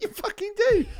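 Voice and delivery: hammed-up disbelief at her; mock exasperated retort. To his girlfriend about tomatoes, mock exasperated retort. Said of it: "I was like".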